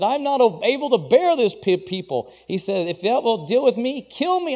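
desperate pleading cry; despairing plea. Acted. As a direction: cry out at the end of your rope. Moses crying out in despair.

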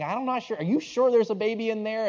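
high-pitched, skeptical questioning; acted puzzled doubt; doubtful husband. Husband doubts her flat belly.

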